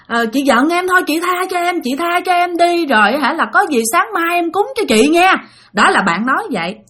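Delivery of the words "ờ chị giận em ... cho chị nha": raised, placating beg; desperate pleading. Retold or imitated. Sleeper promising the ghost offerings tomorrow, begging in a higher voice. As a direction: appease an angry spirit meekly. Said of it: "muốn nói ra để mà năn nỉ".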